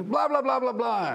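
loud rousing babble. Shown as a demonstration. Comic addressing a united room, loud and high. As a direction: rouse the crowd with nonsense. Crowd unified after gathering.

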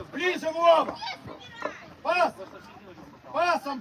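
loud urgent shout; yelling from the sidelines. Real, not acted. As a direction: bark it across the field. Coach yelling at players.

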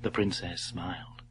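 ordinary delivery

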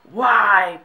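very loud, drawn-out WHY; mock exclaimed why. Vlogger acting out a typed question, mock exclaimed why. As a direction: belt out the question in mockery. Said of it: "And then she's gone".

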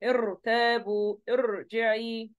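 ارتابوا and ارجعي very slow with a heavy ra; slow thick ra. Shown as a demonstration. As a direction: draw out each word with the ra thick. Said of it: "هنا بتكون هي ساكنة". (نطق بطيء بالراء المفخمة)

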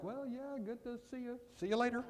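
high-pitched, nervous mock brush-off; mock awkward reply. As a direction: play a flustered kid edging away with a high little voice. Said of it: "I was like".

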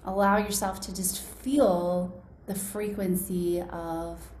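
hushed and close, easing them into calm; right in your ear. Acted. Leads a guided body meditation.

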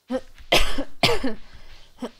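harsh coughing burst, loud breath; coughing fit. Real, not acted. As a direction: cough hard, real and involuntary. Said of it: "pretend that didn't happen".

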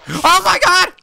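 shrieking high and loud in a sudden fright; terrified scream. Real, not acted. Gamer jump-scared by a mob, screaming. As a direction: let out a real panicked scream. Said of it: "I am so sorry I screamed".

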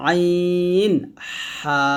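আইন হা held very long from the middle of the throat; very slow, sustained demonstration of আইন হা; mid-throat articulation. Shown as a demonstration. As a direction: stretch আইন হা right out, sounded mid-throat. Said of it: "মাঝখান থেকে উচ্চারণ করব".

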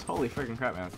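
ordinary talk, nothing marked

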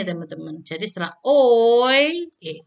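ordinary delivery

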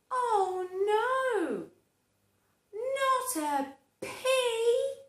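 high loud slow wail; horrified cry. Acted. Scared veggies wailing, high and slow. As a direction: cry out in horror.

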